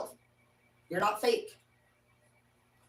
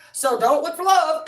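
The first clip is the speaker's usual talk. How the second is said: loud exclamation; 9 semitones higher, 11 dB louder than usual. Real, not acted. Exclaims after her outburst.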